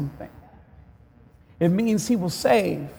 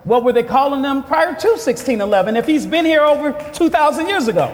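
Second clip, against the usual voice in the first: high, loud, pressing preacher's question; fired-up sermon delivery; impassioned preaching; 7 semitones higher, 5 dB louder, faster than usual. Real, not acted. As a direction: raise the voice and challenge the congregation.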